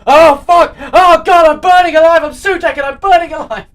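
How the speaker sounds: agonised shrieking while burning alive; burning scream. Pretend. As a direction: scream in pain, very high and loud. Imagined Sutek burning in TARDIS.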